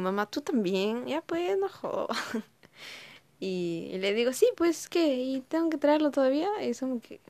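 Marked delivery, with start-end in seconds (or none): 0.0-2.2 queja, mucho más aguda al final; hija harta. Retold or imitated. 4.3-6.5 réplica retadora, mucho más aguda y fuerte; hija desafiante. Retold or imitated.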